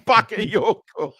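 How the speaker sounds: comic Geordie punchline; proper Geordie. Acted. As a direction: draw out the name in broad Geordie. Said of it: "That's proper Geordie, that".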